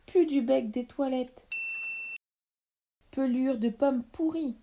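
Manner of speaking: witch's squabbling tirade; escalating insults. Acted.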